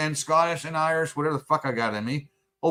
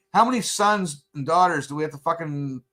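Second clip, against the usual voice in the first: ordinary talk, nothing marked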